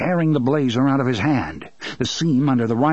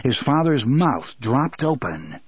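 ordinary delivery, nothing marked

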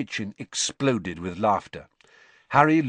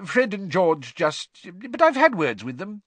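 hesitant, high-pitched placating; falter, then hurry to reassure; mumbled excuse; 9 semitones higher, 4 dB louder than usual. A narrator's reading. Husband covering for his sons to wife, faltering. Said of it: "mumbled Mister Weasley".

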